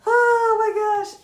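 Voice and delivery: high squealing laugh through words; laughing hard. Real, not acted. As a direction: crack up mid-phrase. Laughing fit at funny image.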